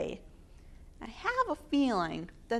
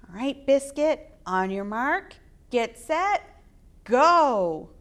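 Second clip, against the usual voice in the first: race start call; 3 semitones higher, 8 dB louder than usual. Acted.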